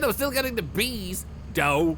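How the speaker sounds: emphatic repeat. Real, not acted. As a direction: insist on it, raising your voice. Repeats louder to chat.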